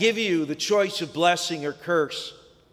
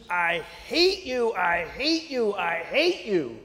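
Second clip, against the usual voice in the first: slow, understated angry cry; a hint of a furious son yelling into the chasm; mild mock scream; 3 semitones higher, slower than usual. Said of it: "and he screams".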